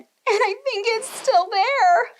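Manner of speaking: a tiny bear, worried and slow; little bear voice. Acted. Little Wee Bear tells his family, small worried voice.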